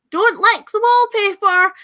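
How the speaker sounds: high, loud, slow stern warning; a guide warning tourists off the wallpaper, high, loud and slow; guide's warning. Retold or imitated. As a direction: bark it out like a tour guide. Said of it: "the guides will be like".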